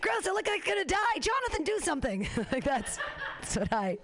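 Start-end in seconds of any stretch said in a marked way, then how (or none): 0.0-2.3 mock squeamish shriek. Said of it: "I'd be like".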